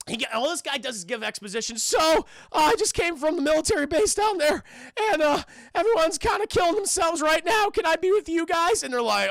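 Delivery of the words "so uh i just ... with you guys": high, loud, panicky stammer; Morty voice. Retold or imitated. Military guy stumbles out, high panicky loud yelp. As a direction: yelp it like a cartoon nervous teen. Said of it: "He's like fucking Morty".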